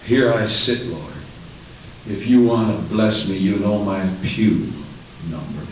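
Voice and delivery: low, unbothered mock prayer; sit back and let God come to you; passive churchgoer. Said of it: "What is this passive".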